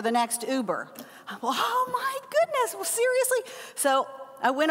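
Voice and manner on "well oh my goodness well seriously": high, whiny exasperated cry; mock despair. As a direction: wail at the bad news, half joking. Thirty minute Uber wait.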